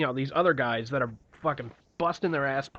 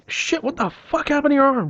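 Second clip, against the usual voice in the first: high, loud shocked yell; shocked outburst; 13 semitones higher, 7 dB louder than usual. Retold or imitated. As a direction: react like you just saw a broken arm. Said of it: "He's like".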